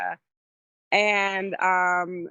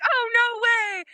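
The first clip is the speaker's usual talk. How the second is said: high, slow "oh, no way"; delighted disbelief, drawn out; the producer hearing who recommended him; amazed; slower than usual. Retold or imitated. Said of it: "He's like".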